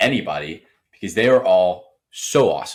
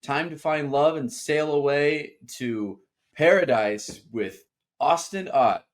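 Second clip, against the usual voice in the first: announcer voice; 7 semitones higher, slower than usual. Acted. Dramatic show intro.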